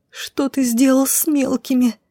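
high, slow, plaintive question; anguished moan. A narrator's reading. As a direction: moan it out in dread, high and drawn out. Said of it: "Буквально простонала я".

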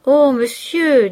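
narrated cry of dismay; pleading. A narrator's reading. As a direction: implore him, stretching the words. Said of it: "dit Victorine en joignant les mains".

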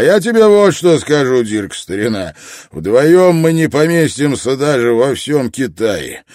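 loud, high-pitched retort; defiant challenge. A narrator's reading. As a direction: throw down a challenge loudly. Character's defiant challenge to Dirk.

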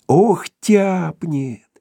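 gleeful anticipation. A narrator's reading. Dog repeats, gleefully.

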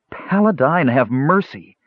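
high, horrified appeal; cry of horror. A narrator's reading. Young man calling on Paladine for mercy, horrified. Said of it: "the young man cried in horror".

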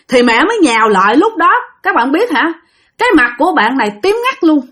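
ordinary delivery, nothing marked

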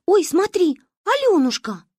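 high loud excited cry; excited child. Acted. Manya excitedly points out the girl to her friend. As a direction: point and gasp in delight.

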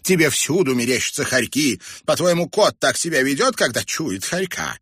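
high, loud scoffing; teasing. A narrator's reading. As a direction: poke fun at a silly notion. Woman laughs and mocks the boy.

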